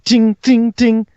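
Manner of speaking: high, loud "ting" sound effect; sharp repeated metallic ting; imitated clanging. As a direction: ring out like clashing blades. Game master imitates clashing weapons.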